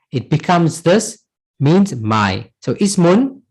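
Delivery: ordinary talk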